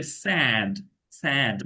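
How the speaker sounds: স্যাড with the jaw dropped wide open; স্যাড with a wide æ vowel; open jaw sad. Shown as a demonstration. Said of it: "চোয়াল একদম খুলে যাবে একেবারে".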